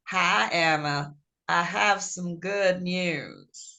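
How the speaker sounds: slow, low Memphis southern accent imitation; slow Memphis drawl. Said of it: "it was a slow southern, you know, the Memphian".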